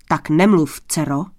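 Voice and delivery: loud, stern rebuke from a king, dropping low; přísný král. A narrator's reading. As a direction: cut her off as a stern father, voice falling at the end. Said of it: "napřímil se král". (přísné otcovské napomenutí)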